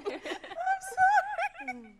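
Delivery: very slow, high, apologising through laughter; sorry gasped out between giggles; laughing apology. Real, not acted. Host apologises to contestant while laughing. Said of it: "I am dying here on set".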